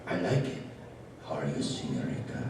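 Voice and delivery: low Mexican puppet voice; deep talking burrito. Acted.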